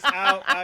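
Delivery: sharp yelps of pain. Pretend. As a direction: cry out as if pecked. Pecked by crows on stream.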